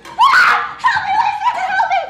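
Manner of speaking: very high, very loud screaming plea; terrified scream. Acted. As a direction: scream for help in terror. Hermia wakes from nightmare.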